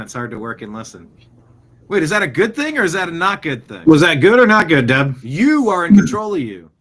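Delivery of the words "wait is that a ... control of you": loud, high comic outburst; mock scolding. Host teasing a listener who can't work, mock scolding loudly. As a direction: scold with hammed-up force, building to a shout.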